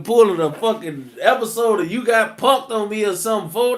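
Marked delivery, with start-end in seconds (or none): none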